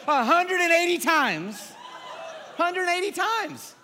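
high, slow, laughing through the number; laughing, amazed delivery; laughing disbelief. Real, not acted. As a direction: savour the huge figure with a laugh.

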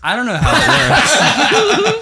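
breaking into laughter; laughing. Real, not acted. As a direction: laugh through the words. Laughing at the joke.

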